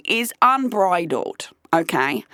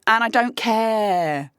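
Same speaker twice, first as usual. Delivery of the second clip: ordinary delivery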